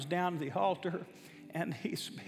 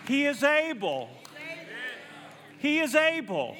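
building, louder and higher with each He is able; preaching climax; 7 semitones higher, 3 dB louder than usual. Real, not acted. Pastor driving the congregation to faith, building to full preaching shout. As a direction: preach it home, rising to a shout.

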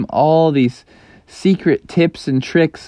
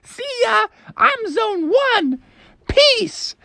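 sing-song it like a gloating cartoon mom; teasing mom voice; slower than usual. Mom leaving Froggy behind at the gate, very high and smug.

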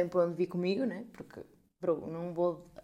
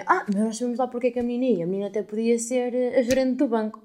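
loud, higher mock of the man; 5 semitones higher, 9 dB louder than usual. Mocking the man in the queue who sided with the lady, loud. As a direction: mimic the man sneering. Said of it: "o senhor me disse assim".

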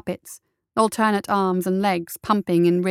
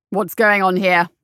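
loud, businesslike demand; a touch of brisk officer; 5 dB louder than usual. A narrator's reading. Captain surprises the sentries at the archway, brisk. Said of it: "a brisk voice asked".

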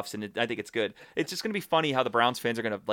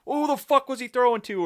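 high-pitched, louder mock outrage; griping fan; 12 semitones higher, 5 dB louder than usual. Mimicking a bar fan griping at a bad throw, high mock outrage. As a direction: whine it high like an exasperated armchair critic.

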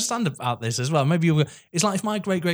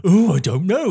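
mock clueless reply; 5 semitones higher, 7 dB louder than usual. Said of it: "And everyone's like".

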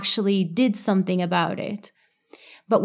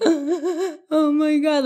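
much higher, very slow, choked sobbing words; hysterical crying; 8 semitones higher, much slower than usual. Shown as a demonstration. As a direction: squeeze it out as if crying too hard to speak. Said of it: "like, you can't even speak".

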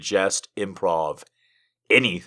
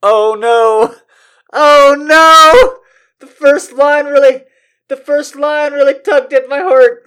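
much higher, loud hammed-up wail; mock heartbroken; 15 dB louder, slower than usual. Streamer reacting to a love letter, hammed-up heartbroken wail. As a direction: gush like the letter broke your heart.

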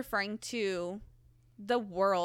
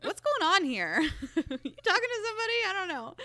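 put-on light, higher puzzled young woman; a hint of a baffled Tessa; 9 semitones higher than usual. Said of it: "Tessa's like".

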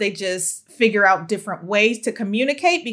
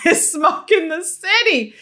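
laughing; 6 semitones higher than usual. Real, not acted. Repeats joke with laugh.

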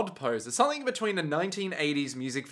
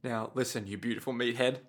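low, hushed reassurance; calm him down gently; soothing partner; 6 semitones lower, faster than usual. Acted. Tiziano calms the wounded Squalo.

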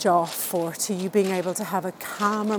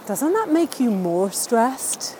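ordinary talk, nothing marked

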